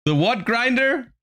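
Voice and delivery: high, slow, baffled question; incredulous what. Real, not acted. Streamer reacting to the clip's odd channel name, incredulous. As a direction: disbelief at a silly name.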